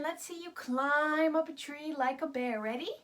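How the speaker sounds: ordinary delivery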